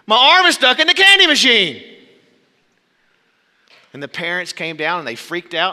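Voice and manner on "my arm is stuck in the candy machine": put-on high, very loud kid's scream; panicked scream. Trapped little boy screams for help from the candy machine, screaming. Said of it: "he started to scream".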